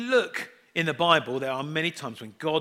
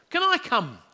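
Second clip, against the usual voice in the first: put-on much higher, bright child's voice; eager little boy; 9 semitones higher, 4 dB louder than usual. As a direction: chirp it like an excited five-year-old. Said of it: "our son, Daniel".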